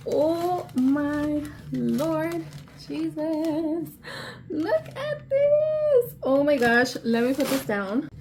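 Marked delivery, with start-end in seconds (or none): 4.5-6.1 much higher, slow and thrilled; drawn-out high gasp of delight; excited squeal. Real, not acted. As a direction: squeal it out to the viewers. Showing the shoes to camera.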